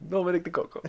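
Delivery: name laughed out in a mock Italian accent; laughing mock Italian. Real, not acted. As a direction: chuckle the name like a hammy Italian. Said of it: "can only say his name".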